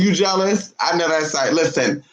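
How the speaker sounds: ordinary talk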